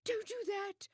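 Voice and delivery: high, breathless gasp of fear; gasped plea. A narrator's reading. Widow gasping at Poirot, high and frightened. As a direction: gasp it out in alarm. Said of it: "she gasped".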